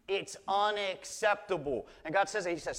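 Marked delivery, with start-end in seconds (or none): none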